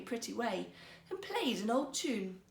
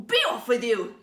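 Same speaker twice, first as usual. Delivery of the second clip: loud angry shout; 11 dB louder than usual. Acted. Emperor to the court ladies, furious shout. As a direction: drive them off with a furious yell. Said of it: "he shouted".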